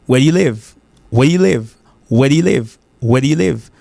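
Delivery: "Where do you live" with do-you fused as "dyoo"; casual linked "Where do you live"; connected American accent. Shown as a demonstration. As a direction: run "Where do you live" together, "weh-dyoo-liv". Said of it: "ou ka tande deux accents".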